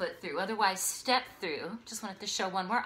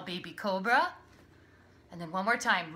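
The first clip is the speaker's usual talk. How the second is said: usual speaking voice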